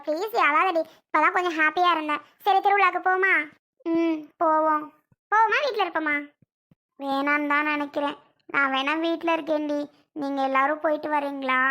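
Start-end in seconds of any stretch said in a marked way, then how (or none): none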